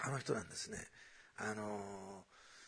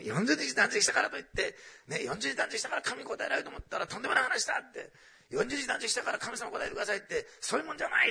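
high, loud imitation of a blunt faster's scolding; mock-scold with punchy, raised-pitch force; forceful rebuke; 11 semitones higher, 6 dB louder than usual. Fasting expert scolding those who boast of forty days, forcefully. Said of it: "ああいう人が言うとなんか迫力ありますね".

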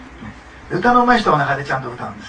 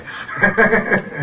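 louder, higher burst of laughter; amused laugh; 6 semitones higher, 6 dB louder than usual. Real, not acted. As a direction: laugh off what you won't tell. Laughs after holding back details. (声を上げて笑う)

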